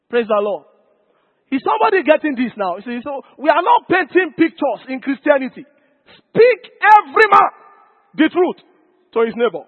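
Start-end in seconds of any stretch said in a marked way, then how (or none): 1.5-9.6 raised, driving pulpit delivery building to a shout; full-force preaching. Real, not acted. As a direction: build to a high, loud scripture command.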